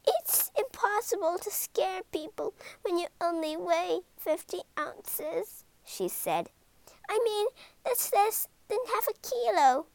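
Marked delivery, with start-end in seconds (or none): 0.1-5.6 tiny monster grumbling sadly to Ben. Acted. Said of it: "the monster said sadly". 7.1-9.9 high, small creature voice; tiny monster voice. Acted.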